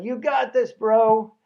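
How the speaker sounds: imitated high, loud bro cheer; grinning yell. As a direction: yell encouragement with a grin. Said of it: "grinning and yelling".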